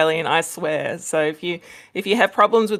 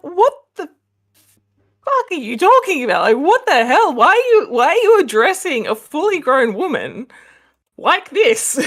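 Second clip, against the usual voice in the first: high, loud mock disbelief; outraged reaction; 8 semitones higher, 5 dB louder than usual. Viewers react to him talking down to her, mock outraged. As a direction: act out a crowd's incredulous outrage. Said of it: "everyone was like".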